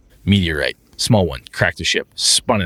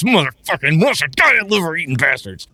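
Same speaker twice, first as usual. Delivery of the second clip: frustrated player swearing; 9 semitones higher than usual. Retold or imitated.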